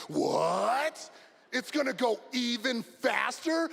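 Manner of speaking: drawn-out, rising mock disbelief; slow, incredulous question, imitating excited kids; amazed kids. Kids amazed at faster sled.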